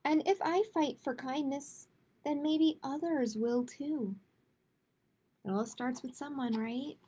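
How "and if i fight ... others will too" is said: gentle, measured picture-book read-aloud; reading to children. A narrator's reading. Librarian reading a kindness book to kids, gentle read-aloud.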